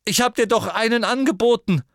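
put-on high, loud indignant boy; Ron voice, high and loud. A narrator's reading. Ron voiced, dangling in air.

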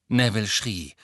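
plain reading voice

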